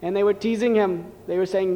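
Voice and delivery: ordinary delivery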